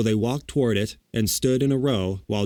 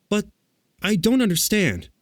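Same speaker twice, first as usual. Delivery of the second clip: put-on high, puzzled girl's voice; bewildered; 10 semitones higher than usual. A narrator's reading. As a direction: say it high, at a loss. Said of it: "said Dorothy in bewilderment".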